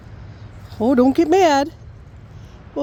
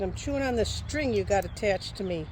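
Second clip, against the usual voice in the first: softly voicing the dog, hushed; hushed pet voice; 12 dB quieter than usual. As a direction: murmur it like a dog narrating itself. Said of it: "She said".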